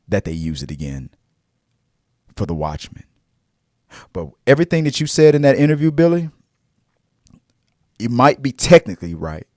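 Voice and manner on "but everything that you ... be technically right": loud, fast, fired-up rant at Billy; heated call-out. Real, not acted. Fan calling out Billy over his interview, loud and heated. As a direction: let him have it, loud and quick.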